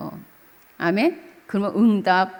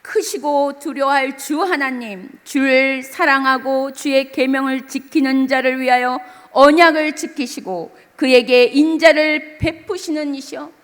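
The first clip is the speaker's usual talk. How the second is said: scripture reading; 5 semitones higher, 6 dB louder than usual. Acted. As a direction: read the verse like a sacred proclamation. Preacher reads scripture aloud. (높고 느린 장엄한 성경 낭독)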